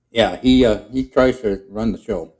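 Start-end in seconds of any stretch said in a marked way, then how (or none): none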